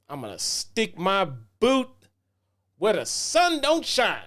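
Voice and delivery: lightly voiced tough threat, rising high at the end; a hint of a cocky wrestler promo; quoting Kenny King. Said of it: "He threatens to send his boot".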